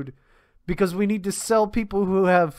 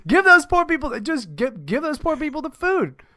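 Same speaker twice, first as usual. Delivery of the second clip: higher and louder, pleading in frustration; exasperated plea; 8 semitones higher, 5 dB louder than usual. Real, not acted. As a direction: beg them to just give the food away. Exasperated plea about wasted food.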